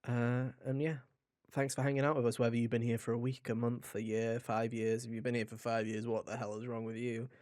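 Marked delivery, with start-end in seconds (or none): none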